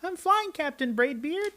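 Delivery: put-on high boy reassuring the captain; boy's voice. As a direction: chirp it like a carefree boy. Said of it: "what do you think they're thinking".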